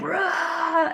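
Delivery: laughing high mock roar; playful imitation of a roar. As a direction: imitate the men roaring, half laughing. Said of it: "like, roaring".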